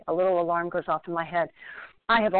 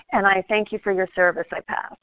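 ordinary talk, nothing marked

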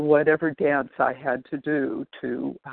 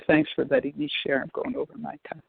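ordinary talk

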